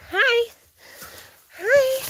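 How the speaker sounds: very high-pitched little hello; high pet greeting. Real, not acted. Owner greets the animals while hunting for pots, high-pitched.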